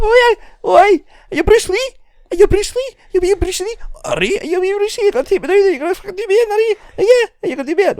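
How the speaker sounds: very high, loud Scottish rant; exaggerated Scottish challenge, shrieked up high and garbled; thick Scottish uncle. Uncle ranting at taekwondo nephew, thick Scottish accent. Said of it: "He's like".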